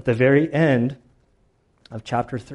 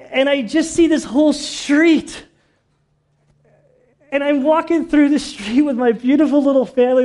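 choked up; 10 semitones higher, 8 dB louder than usual. Real, not acted. Preacher telling of walking that street with his family, tearfully choked up. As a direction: voice very high and loud, close to weeping. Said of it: "I'm not sorry for getting choked up".

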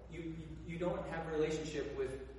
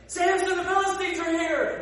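high, loud hammed-up warning cry; Delilah's staged alarm, high and loud; mock alarm shout; 14 semitones higher, 10 dB louder than usual. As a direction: yell it like the enemy is at the door. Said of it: "she's like".

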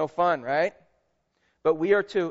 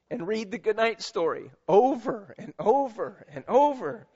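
sermon tribute read with lifted voice; 4 semitones higher than usual. Acted. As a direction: proclaim it to every mother in the room. Continues Mother's Day tribute reading.

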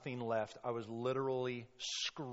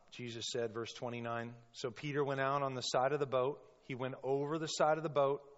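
usual speaking voice

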